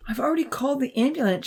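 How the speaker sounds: high, quick, unsettled voice; rattled high reply; shaky. A narrator's reading. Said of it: "she said shakily".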